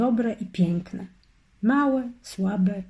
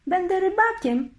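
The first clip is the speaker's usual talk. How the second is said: joyful; 10 semitones higher than usual. A narrator's reading. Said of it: "ucieszył się Wars".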